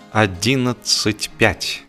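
plain reading voice

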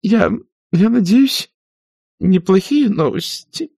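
put-on high, slow, quavering old aunt; a fluttery spinster asking in fear; trembling voice. A narrator's reading. Aunt Pittypat asks Scarlett in a trembling voice. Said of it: "с дрожью в голосе вопросила".

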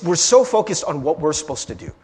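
ordinary talk, nothing marked

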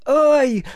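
loud, high "ow"; sudden high cry of pain; pained yelp. A narrator's reading. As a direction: yelp from a smack on the head. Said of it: "получив крепкий подзатыльник".

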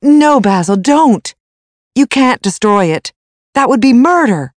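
high, loud cry to stop; alarmed plea. A narrator's reading. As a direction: desperately beg someone to stop. Dorian stops Basil destroying the picture.

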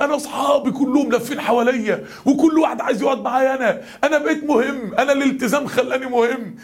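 put-on high-pitched, overjoyed young man; imitated wild excitement. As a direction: act out a lad amazed he matters now. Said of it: "الولد رجع لنا هيتجنن". (تقليد شاب هيتجنن من الفرحة)

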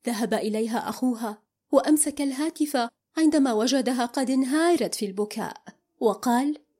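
ordinary delivery

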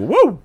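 high, loud woo; celebratory whoop. Real, not acted. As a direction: let out a loud, high cheer. Celebrates bringing talk full circle.

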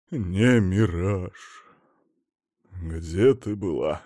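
slow, low rasping voice; sick husband croaking at his wife; hoarse croak. Acted. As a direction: croak it out weakly. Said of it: "прохрипел Мамонов".

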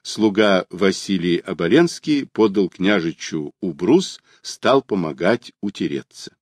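ordinary delivery, nothing marked

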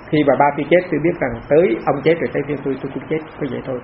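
usual speaking voice

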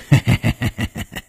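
long high snickering "hehe"; airy, rattling run of chuckles; sly chuckle. A narrator's reading. 武刚 brushing off the concern, chuckling. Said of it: "武刚笑了".

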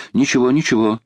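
plain reading voice

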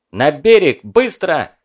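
loud urgent shout. A narrator's reading. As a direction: yell the order to get out. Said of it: "закричал Кузнецов".

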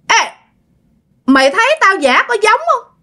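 put-on very high-pitched old demon hag, cackling between phrases. Old demon woman mocking the frightened girl, high cackling voice. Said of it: "Bà làm vậy đó các bạn".

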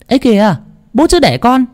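high, fast sneering woman's call; shrill voice. A narrator's reading. Scheming sister-in-law mocking from the doorway, shrill and fast. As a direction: ring out mocking and sharp. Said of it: "giọng nói lanh lảnh vang lên".

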